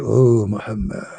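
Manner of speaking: low, drawn-out ghostly call; angel apparition voice. Acted. The angel appearing in front of Muhammad, low eerie call.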